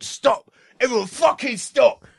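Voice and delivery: high, forceful angry shout; Ray Winston shouting. Retold or imitated. Actor halting a drama workshop, imitated angry shout. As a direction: cut the scene off with a yell. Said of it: "screamed at by the scariest man".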